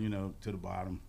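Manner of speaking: ordinary talk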